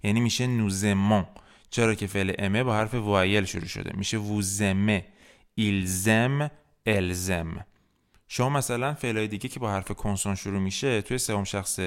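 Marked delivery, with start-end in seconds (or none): 0.5-1.3 "نوزمان" with the s of nous voiced as z; "نوزمان" run together, nou-zemon; liaison. Shown as a demonstration. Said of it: "حالا تلفظ بشه". 4.2-7.7 "ووزمه، ایلزم، الزم" each joined with a buzzing z; "ووزمه، ایلزم، الزم" as linked French words; liaison. Shown as a demonstration. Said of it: "حالا تلفظ بشه".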